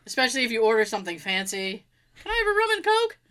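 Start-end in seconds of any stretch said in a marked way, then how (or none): 2.2-3.2 timid customer voice. Retold or imitated. Customer ordering fancy drink.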